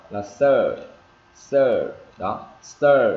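loud, long "er" in "sir" and "stir"; lengthened, falling "sir" and "stir"; R-coloured vowel. Shown as a demonstration. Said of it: "Nghe nó hơi ờ một tí".

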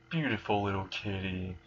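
hushed, drawn-in pet-name tease; soft teasing menace. Acted. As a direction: drop softly onto the pet name. Creepy teasing of terrified Koneko.

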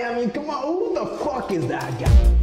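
ordinary talk, nothing marked

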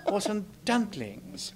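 prim English gentleman puppet, preening; British puppet. Pretend. Holds British puppet character.

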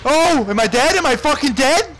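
high, loud, frantic questioning; panicked shout. Real, not acted. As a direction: yell it in alarm. Thinks he has died.

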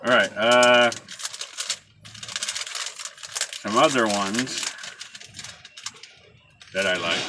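talking around pipe stem; pipe in mouth. Real, not acted. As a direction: teeth clenched on pipe. Seen 1: Pipe clenched in teeth from lighting until about 8.9; gone by 9.6.

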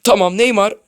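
urging the striker on with a yell; loud cheer. Real, not acted. Shouts at player in game.

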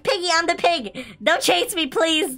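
high frantic begging; panicked pleading. Pretend. Begging the piggy not to chase.

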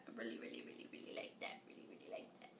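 whispered, breathy delight. Real, not acted. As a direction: whisper it like a secret. Whispers her delight to herself.

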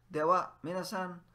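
loud sign-off call. Shown as a demonstration. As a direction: call it out brightly. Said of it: "what do we say when we leave?".

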